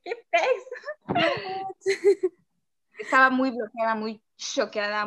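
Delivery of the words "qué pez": laughing disbelief. Retold or imitated. Baffled reaction, laughing.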